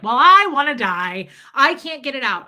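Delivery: hammed-up horror at germs; mock despair. Retold horror at toilet drop.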